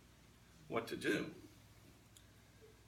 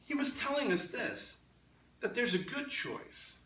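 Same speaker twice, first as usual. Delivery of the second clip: ordinary delivery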